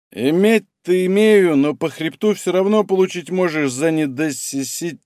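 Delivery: put-on slow, tongue-tied drunk. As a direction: slur and stumble slowly. Drunk, stumbles over word.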